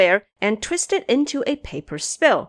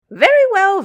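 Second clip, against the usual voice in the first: higher, louder gleeful burst; laughing; 12 semitones higher, 7 dB louder than usual. Acted. Xing Zhe gleefully approving the offerings. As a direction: chuckle it out. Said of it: "Xing Zhe laughed and said".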